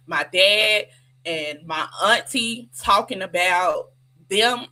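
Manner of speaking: ordinary delivery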